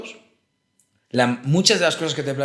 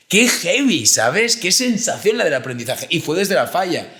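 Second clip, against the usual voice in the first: normal talking voice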